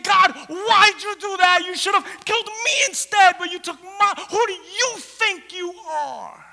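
high, loud, anguished protest; railing at God. Acted. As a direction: rage at God as a bereaved mother. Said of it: "was saying".

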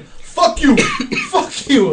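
high-pitched mock angry yell; imitation of a pissed-off man cursing. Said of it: "Tommy is getting pissed".

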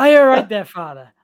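loud, high Irish sitcom greeting dropping away; Irish accent. Shown as a demonstration. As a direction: burst out in a hammed-up Irish accent. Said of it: "can you do your Irish accent?".

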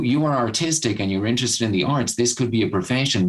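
ordinary talk, nothing marked

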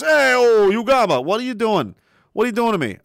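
high, punchy mock protest; hammed-up wise guy. Host reads chat message aloud, hammed-up wise-guy protest.

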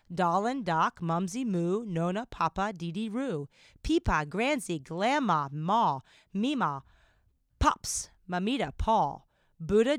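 loud, emphatic poetry reading; poem recital. Real, not acted. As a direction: project each name in a rhythmic, performed list. Reads out a poem.

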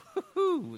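high-pitched clown giggle; clown cackle. Acted. Clown character cackles for interviewer.

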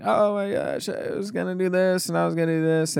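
a hint of sobbing panic, not real tears; a lightly voiced, teary cashier begging; mock crying plea. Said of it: "the seven eleven guy's crying".

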